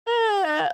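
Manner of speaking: very high, short shocked shriek; mom's shocked shriek. Retold or imitated. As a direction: squeal in horror at the gift. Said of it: "she opens it, and she's like".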